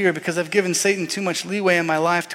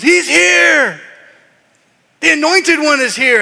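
much higher, shouted news; yelling shepherds; 8 semitones higher, 7 dB louder, slower than usual. Retold or imitated. Shepherds running into town, yelling the news. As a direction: yell it to the whole town. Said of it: "yelling at the top of their lungs".

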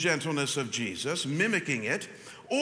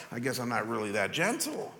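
mock deflated admission; 8 semitones lower, 3 dB quieter than usual. Churchgoer admitting failure, mildly deflated. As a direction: lower and softer, a touch of defeat. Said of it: "you're gonna say".

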